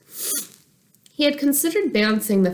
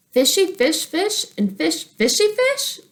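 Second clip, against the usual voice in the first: put-on much higher, louder giggly little-girl voice; sing-song a silly question like a small child; 11 semitones higher, 4 dB louder than usual. Little sister teasing fish names, silly child voice.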